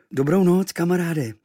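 high, drawn-out, weary good night; tired. A narrator's reading. Boy exhausted after a pillow fight, tired. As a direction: say it worn out but friendly. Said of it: "řekl jsem unaveně".

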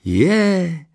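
high, loud, gushing neighbour; delighted cry. A narrator's reading. Neighbour spotting the family's new dog, thrilled. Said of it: "vykřikla nadšeně".

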